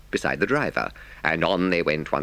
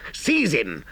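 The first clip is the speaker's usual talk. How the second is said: higher cry of alarm; outraged cry; 7 semitones higher than usual. A narrator's reading. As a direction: call out for his capture. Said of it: "they cried".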